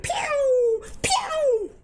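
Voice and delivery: high-pitched, drawn-out pew; playful laser pew. Pretend. Streamer playing with the camera, high laser pew. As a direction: zap out high laser noises.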